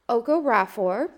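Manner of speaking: normal talking voice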